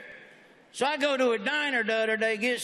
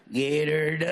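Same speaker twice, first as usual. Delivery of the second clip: low catchphrase; 6 semitones lower than usual. Acted. Comedian opening the act for the crowd, low catchphrase. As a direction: say it low, stretching the last word. Performs his catchphrase first time.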